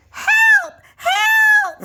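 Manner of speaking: very high, loud screaming for help; screamed. Acted. Little wolf flees the splash, screaming. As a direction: scream for dear life. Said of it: "screamed Little Wolf".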